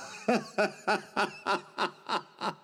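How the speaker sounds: soft, mocking laugh; amused villain laugh. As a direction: laugh off the threat.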